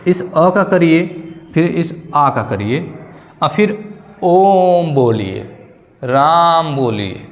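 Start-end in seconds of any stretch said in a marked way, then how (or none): 4.2-5.4 ओम spoken very slowly, its vowel held long; slow, lengthened ओम बोलिए; drawn-out Om. Shown as a demonstration. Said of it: "प्लुत मात्रा में तीन गुना समय". 6.0-7.3 राम spoken very slowly with the आ held long; slow, lengthened राम बोलिए; drawn-out Ram. Shown as a demonstration. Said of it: "प्लुत मात्रा में तीन गुना समय".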